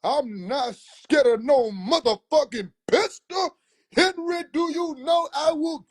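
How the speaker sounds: uncle with a pistol; uncle's threat acted.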